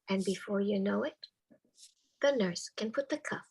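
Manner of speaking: ordinary delivery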